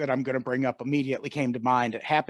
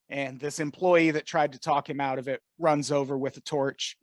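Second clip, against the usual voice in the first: normal talking voice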